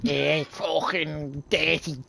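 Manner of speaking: slow Scouse cursing, drawn out; angry Scouse. Shown as a demonstration. Gamer showing how anger turns them Scouse, Scouse cursing. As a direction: grumble insults in a slow Scouse accent. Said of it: "I start sounding Scouse when I get angry".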